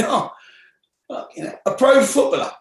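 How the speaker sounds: a retold warden's stunned outburst; imitating the warden's surprise. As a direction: act gobsmacked at the news. Warden amazed at a footballer.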